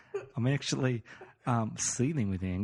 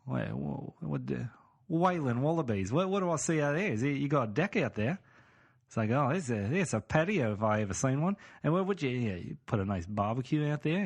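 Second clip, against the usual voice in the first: casual Aussie sizing up the place; Australian accent; 2 semitones higher than usual. Acted. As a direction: put on an Aussie accent, slightly slipping. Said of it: "my accent's slipping".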